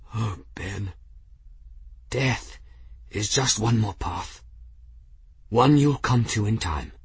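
gentle, halting last words; lightly voiced dying man, pausing; dying monk. A narrator's reading. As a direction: console a friend with failing breath.

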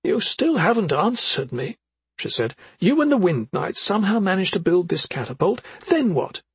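a hint of an anxious mother; mother's voice. A narrator's reading. Effie insists.

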